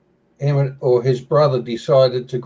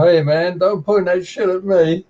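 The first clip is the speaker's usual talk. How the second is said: higher-pitched protesting kangaroo; lightly voiced kangaroo; 6 semitones higher than usual. Kangaroo to the farmer blasting away with a shotgun, higher mock protest. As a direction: complain as a mildly indignant talking kangaroo. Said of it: "the kangaroo's going".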